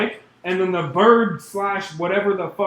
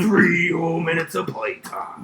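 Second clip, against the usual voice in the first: wrestling promo; 3 semitones higher than usual. Pretend.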